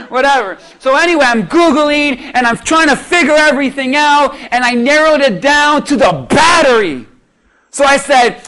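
normal talking voice

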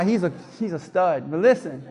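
usual speaking voice

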